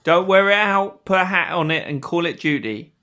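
cheeky British fishmonger patter; put-on British accent. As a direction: play the chipper Renaissance-fair Brit. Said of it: "I need you to drop the accent".

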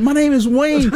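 high-pitched mock plea while dragged away; retold calling out. I'm still screaming through the glass.

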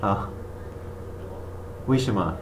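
ordinary delivery

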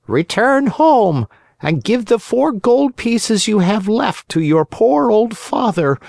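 high, far-off ghost cricket; faint ghostly cricket. A narrator's reading. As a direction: advise gently from far away. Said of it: "in a faint voice".

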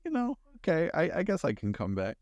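high, grudging "okay I guess"; reluctant actors. Retold or imitated. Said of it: "the rest of them were like".

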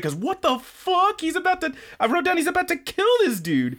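excited disbelief. Real, not acted. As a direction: squeal it in disbelief. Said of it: "excited retelling".

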